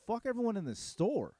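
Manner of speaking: high, disbelieving question thrown back; incredulous echo. Retold or imitated. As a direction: repeat it as if you can't believe he said it. Echoes the boast back in disbelief.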